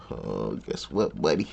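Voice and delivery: a hint of a tough guy; menacing. Imagined threat to intruder.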